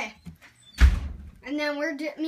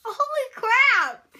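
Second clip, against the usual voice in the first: surprised shout; 6 semitones higher, 5 dB louder, much slower than usual. Real, not acted. Shocked at eyes watering.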